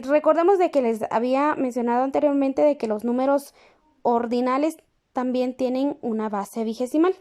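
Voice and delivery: normal talking voice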